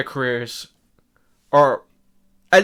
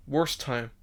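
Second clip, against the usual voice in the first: hiccuping mid-sentence; hiccups; 7 dB quieter than usual. Real, not acted. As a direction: caught by a hiccup. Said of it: "I have the hiccups".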